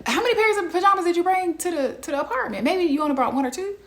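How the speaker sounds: high, fast, incredulous questioning. Acted. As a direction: quiz her in disbelief, quickly.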